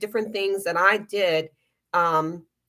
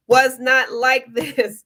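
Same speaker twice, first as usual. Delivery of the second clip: laughing; 5 semitones higher, 6 dB louder, slower than usual. Real, not acted. Laughing at last year's troubles.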